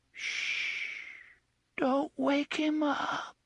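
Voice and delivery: put-on frightened child's hush. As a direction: shush like a frightened little child. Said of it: "the child's voice mourned".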